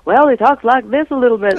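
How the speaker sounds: a hint of a lilting character voice; character voice demo. Shown as a demonstration. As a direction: show the lilting voice lightly. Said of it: "I'm trying to do the voice".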